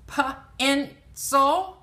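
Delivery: "Pa-in-sol" in three separated beats; sounding out. Shown as a demonstration. Teacher sounding out pencil for learners, "Pa-in-sol" piece by piece. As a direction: split "Pa-in-sol" into p, en, sul with clear gaps. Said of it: "Where's the na in pencil?".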